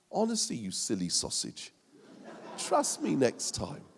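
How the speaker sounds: soft, low and tender; gentle reassurance. Retold or imitated. Jesus holds Peter's hand, softly and tenderly reassuring. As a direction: comfort him like a friend. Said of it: "more like this".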